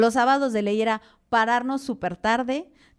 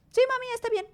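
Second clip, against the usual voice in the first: put-on much higher, childlike reply; daughter's voice; faster than usual. Mother quoting her daughter agreeing to the podcast, much higher.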